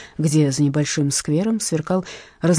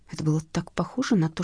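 usual speaking voice

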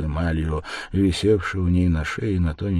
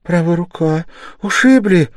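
higher, flustered, rushing in places; flustered mother, voice raised; gushing mother; 10 semitones higher, 8 dB louder than usual. Acted. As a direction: pour out gratitude and worry.

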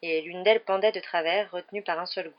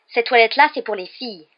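louder, higher wary objection; suspicious; 5 semitones higher, 10 dB louder than usual. A narrator's reading. Mimi objects to boys in the girls' toilet, suspicious. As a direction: challenge the intruders suspiciously. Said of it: "d'un air soupçonneux".

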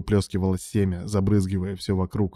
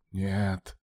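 categorical refusal; 6 dB quieter than usual. A narrator's reading. Ilya refuses his pleading lover, quietly categorical. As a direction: refuse softly but with finality. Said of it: "категорично ответил Илья".

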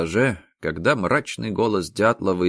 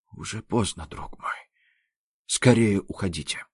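weak choked voice coughing up blood; 5 dB quieter than usual. A narrator's reading. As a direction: force words out choking on blood. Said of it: "Захлёбываясь кровью, выдавил".

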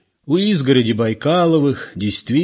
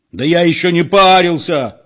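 loud, higher-pitched indignant yell; 7 semitones higher, 6 dB louder than usual. A narrator's reading. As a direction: bellow it in protest. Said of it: "заорал спокойный Петро".

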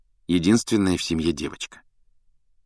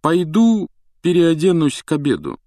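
high, louder affected announcement; lofty, pitched-up pomposity; haughty; 10 semitones higher, 5 dB louder than usual. A narrator's reading. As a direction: announce your exit with self-importance. Haughty Percy makes his exit.

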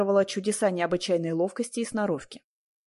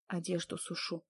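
very soft, barely voiced reply; lips only; 11 dB quieter than usual. A narrator's reading. Woman answering a stranger who found her, very quiet. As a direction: answer under your breath, much softer. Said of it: "ответила я одними губами".

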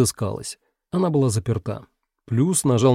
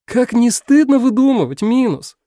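much higher, louder scolding cry; shout; 12 semitones higher, 5 dB louder than usual. A narrator's reading. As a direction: shout a reproach over the fence. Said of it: "крикнула Пятёрка".

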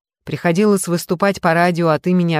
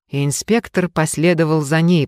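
usual speaking voice

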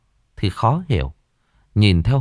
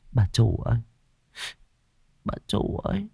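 soft sigh, fading to voiceless; sighing lament; 3 semitones higher, 6 dB quieter than usual. A narrator's reading. Servant missing the mistress, sighing. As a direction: call out weakly with a sigh. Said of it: "Nó thở dài".

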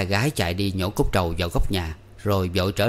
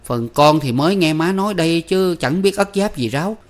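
much higher, louder protest; quick protest; 8 semitones higher, 5 dB louder than usual. A narrator's reading. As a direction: fire back in denial. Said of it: "Tư Cầu cãi liền".

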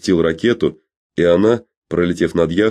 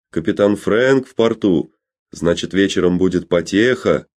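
usual speaking voice

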